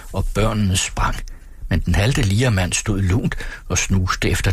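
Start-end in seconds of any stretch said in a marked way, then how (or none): none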